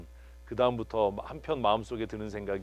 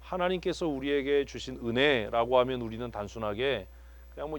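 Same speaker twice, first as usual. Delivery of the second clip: ordinary delivery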